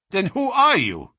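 high, loud captive's demand; agitated challenge; demanding outburst. A narrator's reading. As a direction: burst out with the question, then drop. Captive demands his captor's name.

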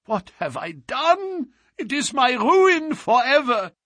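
anguished, agitated outburst; despairing cry. A narrator's reading. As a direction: lament it at the top of the voice. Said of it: "he cried".

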